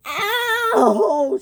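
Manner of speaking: high wailed "ow"; long, high yelp of pain; pained cry. A narrator's reading. Clara left on the track, pained cry.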